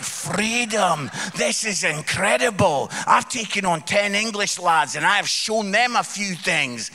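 lightly played triumphant battle cry, loud; William Wallace. Scot exulting over ten English lads, loud mock-heroic boasting. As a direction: declare victory like a mock Scottish hero. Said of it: "I'm like William Wallace".

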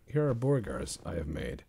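normal talking voice

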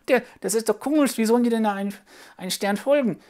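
high, puzzled little puppet voice; a child finding the story odd; small sidekick puppet asking why; curious puppet child. Acted.

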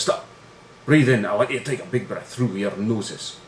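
mock-impassioned speech in a Scottish accent; emotional Scottish captain. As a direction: order the team in a put-on Scottish accent. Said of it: "this very emotional speech".

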